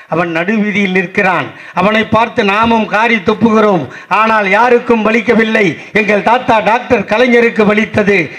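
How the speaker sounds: building to a high, ringing declamation; impassioned rally oration. Acted. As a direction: swell into full rally force, then drop at the close. Declaimed praise builds to climax.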